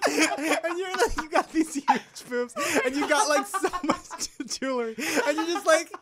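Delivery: high, loud words squeezed through laughter; real helpless giggles, barely getting it out; laughing while talking. Real, not acted. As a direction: tell it while cracking up, dragged out. Telling story while cracking up.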